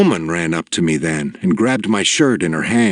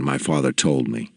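ordinary delivery, nothing marked

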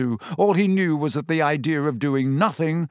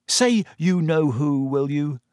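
slower, mildly angry interjection; 2 semitones higher than usual. A narrator's reading. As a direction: cut in with measured irritation. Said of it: "interjected Ron angrily".

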